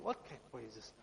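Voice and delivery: low, very soft father's aside; grumbling father. Acted. Father reacting to his insistent son, low quiet grumble. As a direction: grumble it low, almost to yourself.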